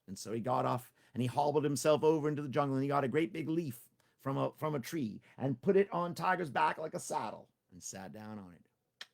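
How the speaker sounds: ordinary delivery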